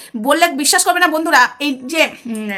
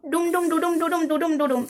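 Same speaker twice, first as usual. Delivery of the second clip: 1 mock drumroll; 2 semitones higher, 6 dB quieter than usual. Dramatic drumroll before a reveal.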